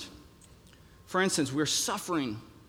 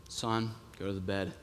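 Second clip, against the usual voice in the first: low, quiet stern father voice; a big father's low order to his son; big stern dad; 8 semitones lower, 8 dB quieter than usual. Retold or imitated. Dad quietly orders his son to the bed, low and stern.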